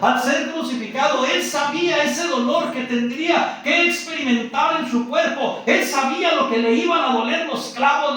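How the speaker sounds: loud, high preacher's cry; full-force preaching. Acted. Preacher to brothers, loud high fervent sermon delivery. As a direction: preach it with full fire to the church.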